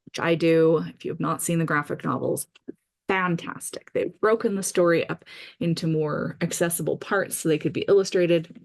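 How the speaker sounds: ordinary talk, nothing marked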